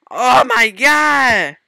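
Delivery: loud, high, drawn-out exclamation; alarmed outcry. Real, not acted. Player reacting mid-game, alarmed outcry.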